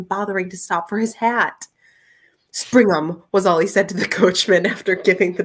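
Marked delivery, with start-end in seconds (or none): 2.5-3.2 loud, short order; urgent command. A narrator's reading. Gentleman ordering his coachman away, loud command. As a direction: call it out to the driver.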